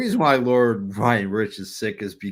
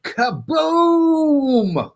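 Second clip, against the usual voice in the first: loud, high kaboom; shouted explosion sound; 14 semitones higher, 7 dB louder than usual. Acted. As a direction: yell it like a blast.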